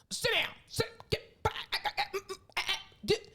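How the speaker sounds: high, sputtering mock scolding; scolding parent. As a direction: imitate a parent snapping orders at kids. Imitating friend scolding kids on phone.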